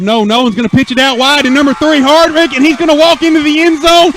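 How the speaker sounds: rising excited shout; touchdown call. Real, not acted. Touchdown run called live.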